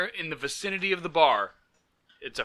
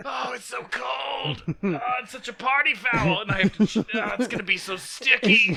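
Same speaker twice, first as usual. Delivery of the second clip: high-pitched moan with a laugh creeping in; whining lament; 8 semitones higher than usual. Real, not acted. Host moans to co-host about sticky pants, whining lament. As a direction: wail your complaint, half laughing.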